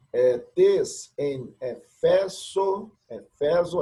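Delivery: slow, careful Greek reading; slow reading aloud. Shown as a demonstration. Teacher sounding out Greek for students, slow. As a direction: sound out each word for the class. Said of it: "teacher reading Greek slowly".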